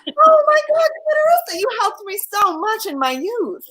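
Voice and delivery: mock gushing. Host plays along gushing.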